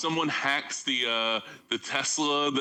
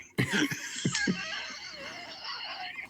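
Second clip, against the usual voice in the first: big burst of laughter; 6 dB quieter than usual. Real, not acted. Cracking up at own impression.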